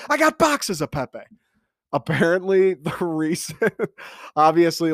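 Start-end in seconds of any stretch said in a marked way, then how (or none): 0.1-1.2 high-pitched frantic outburst; Charlie impression. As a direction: burst out high, like a delusional ranter. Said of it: "Charlie's like".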